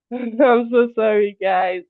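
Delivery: slow, high apology with a laugh; apologizing while laughing. Real, not acted. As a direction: say sorry through a laugh.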